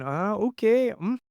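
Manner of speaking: high, breezy ah okay mm yep; mock filler noises from a co-host; mock backchannel noises. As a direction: imitate a co-host murmuring agreement. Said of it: "the other one's just going".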